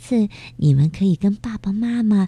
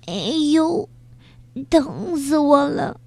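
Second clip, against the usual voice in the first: high, pained cry of a little beetle; 9 semitones higher than usual. Acted. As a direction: yelp from a sudden bellyache. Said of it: "捂着肚子大叫".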